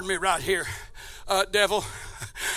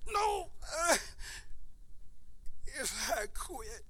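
tearful resolve; 4 semitones higher, 17 dB quieter, slower than usual. Acted. Jesus answering tempter, preacher tearful.

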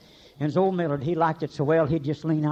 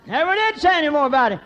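high, loud preaching shout; 10 semitones higher, 8 dB louder than usual. Real, not acted. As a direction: ring it out to the congregation. Preaching climax.

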